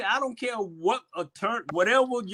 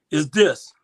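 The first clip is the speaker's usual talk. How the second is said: usual speaking voice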